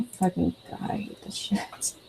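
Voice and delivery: breathy under-the-breath swearing; exasperated whisper. Real, not acted. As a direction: whisper curses at the screen. Annoyed, can't find comment.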